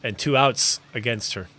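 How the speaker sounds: lively baseball announcer call; sports play-by-play. Acted. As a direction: call the game like a broadcaster.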